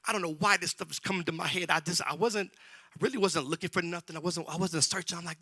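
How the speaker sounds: usual speaking voice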